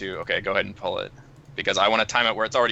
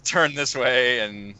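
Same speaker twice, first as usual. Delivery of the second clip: ordinary talk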